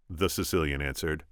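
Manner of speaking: plain reading voice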